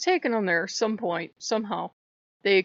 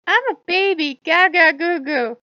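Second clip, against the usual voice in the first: put-on much higher and louder baby voice; mock baby talk; 9 semitones higher, 9 dB louder than usual. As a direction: play the helpless infant, goo goo. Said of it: "And Hermes is like".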